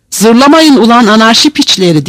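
captain shouting down the objectors; angry shout. A narrator's reading. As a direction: silence them with an angry yell. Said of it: "diye bağırdı".

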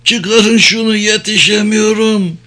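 high, strained cry for help; acted distressed calling out; desperate plea. A narrator's reading. Wounded man begs for help.

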